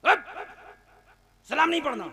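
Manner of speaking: high-pitched, abrupt command; stern order. Acted. Amir forbids the newcomer salam, stern. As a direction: forbid it angrily.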